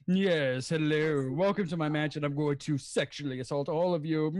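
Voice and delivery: low, theatrical mansion-host voice; Frank-N-Furter. Acted. Impressionist greeting guests to the mansion, low theatrical voice. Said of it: "Frank-N-Furter".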